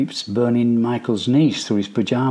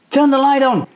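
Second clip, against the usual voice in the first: put-on high, loud frightened yell of a boy; sudden yell; 14 semitones higher, 5 dB louder than usual. A narrator's reading. Scared boy yelling suddenly in the silent room. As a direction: blurt it out loud into the dark. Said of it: "he yells suddenly".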